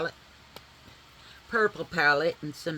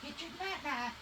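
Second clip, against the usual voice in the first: soft pet talk; 9 semitones higher, 12 dB quieter than usual. Real, not acted. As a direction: coax the dogs gently like babies. Offering dog a snack.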